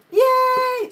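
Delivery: high, loud cheer; excited cheer. Real, not acted. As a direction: shout it out in delight. Celebrating a chat vote.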